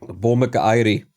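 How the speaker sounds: a hint of a mock Jamaican accent. Gamer playfully tries a Jamaican accent after reading about patois. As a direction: lightly try on a Jamaican accent. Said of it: "I can do that accent".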